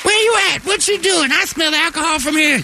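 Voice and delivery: put-on very high nagging girlfriend; whiny high-pitched girlfriend on the phone.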